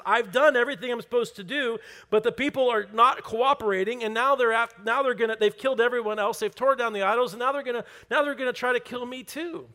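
high, loud, breathless list of grievances; frustrated and angry. Acted. Elijah saying this back to God, angry and exasperated. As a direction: vent at God, stumbling over the complaints. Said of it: "can't you just hear that in his voice".

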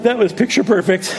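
"That was picture perfect" laughed out sarcastically; laughing irony. Real, not acted. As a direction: laugh at how badly it went. Ironic comment on ruined morning.

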